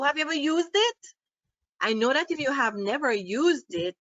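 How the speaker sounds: ordinary talk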